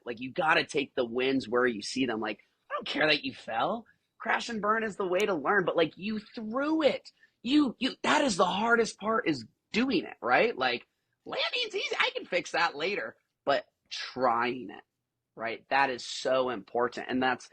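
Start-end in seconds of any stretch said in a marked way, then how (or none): 2.7-13.1 high-pitched, emphatic coach's pep talk; acting out a fired-up coach; impassioned encouragement. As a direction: insist proudly, pushing the pitch up.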